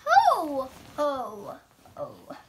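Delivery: lightly voiced Santa ho ho ho, loud start; a hint of jolly Santa chuckle; Santa laugh. Girl reading Peppa book, mock Santa ho ho ho. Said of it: "chuckles Santa".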